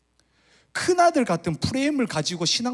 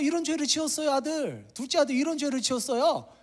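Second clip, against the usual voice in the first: much higher, tattling accusation; accusing elder son; 13 semitones higher than usual. Acted. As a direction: point out the brother's sin to the father. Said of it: "이렇게 죄를 지적하고 있습니다". (아버지에게 동생의 죄를 고자질하는 높은 목소리)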